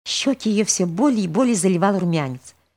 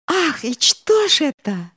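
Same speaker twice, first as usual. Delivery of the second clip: excited; 10 semitones higher, 3 dB louder, slower than usual. Acted. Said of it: "Алёнушка даже руками всплеснула".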